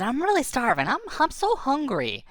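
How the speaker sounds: lightly acted starving creature; hungry creature's whine. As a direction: complain of hunger in a high voice.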